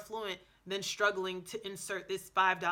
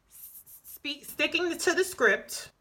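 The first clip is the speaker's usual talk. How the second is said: tongue-tied; 5 semitones higher, 7 dB louder than usual. Real, not acted. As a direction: stumble over the words and restart. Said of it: "but I can't even talk".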